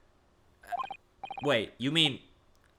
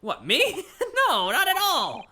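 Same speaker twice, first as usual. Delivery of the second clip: higher and louder, laughing it off; nervous laugh; 11 semitones higher, 5 dB louder than usual. Acted. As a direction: pretend everything is normal.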